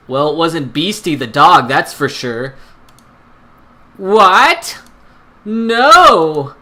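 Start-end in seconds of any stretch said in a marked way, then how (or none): 4.0-6.5 loud, higher shocked protest; indignant outcry. Acted. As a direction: cry out in offended disbelief. Family outburst at accusation.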